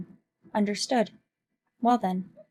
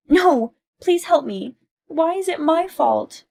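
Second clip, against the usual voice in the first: high, pleading, slowing at the end; desperate plea; 7 semitones higher, 6 dB louder, slower than usual. Acted. Olivia begging her friend for help against her husband, desperate and slow. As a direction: beg for help, stretching the last question.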